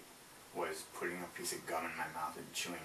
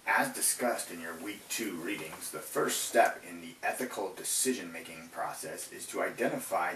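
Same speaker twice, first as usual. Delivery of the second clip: louder formal read-aloud voice; 7 dB louder than usual. Acted. Reads assignment question aloud.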